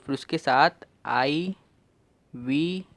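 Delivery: ordinary delivery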